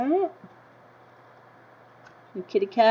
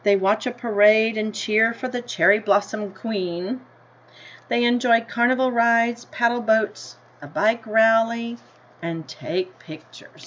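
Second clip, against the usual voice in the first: story reading; 2 semitones higher, 4 dB louder than usual. A narrator's reading. Teacher reading the class a picture book, in an unhurried storybook voice. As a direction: read it like a picture book to kids.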